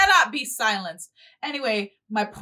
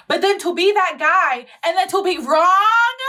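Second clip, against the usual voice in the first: higher pitch climbing into a long "wrong"; exasperated outburst; 7 semitones higher, 4 dB louder than usual. Real, not acted. Berating that guy for being wrong, loudly exasperated.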